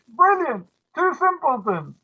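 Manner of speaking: high gleeful exclamation; delighted cry. Retold or imitated. Matchmaker's line retold in English, high delighted cry. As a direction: exclaim it with glee. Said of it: "Or in English".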